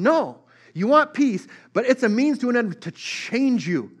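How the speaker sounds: preacher hitting the peak; impassioned preaching. Acted. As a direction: drive the point home from the pulpit. Sermon peak, raised and pushed.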